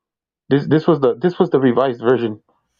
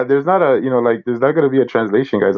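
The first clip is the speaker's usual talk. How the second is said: ordinary delivery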